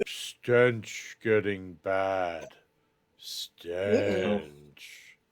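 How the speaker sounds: very slow, drawn-out creature speech; Groon. Acted. Game master's Groon complains about a smell, slow creature voice. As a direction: stretch each word out like a slow-witted creature. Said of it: "You hear Groon go".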